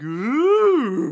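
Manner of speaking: high, loud, swooping ooh; teasing ooh. Retold or imitated. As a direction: join the teasing with a hammed-up ooh. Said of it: "run down the alleyway like".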